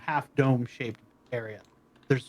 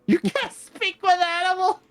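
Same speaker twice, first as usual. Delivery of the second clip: high, sneering little voice; mock the druid as the cat. GM voicing cat, high far.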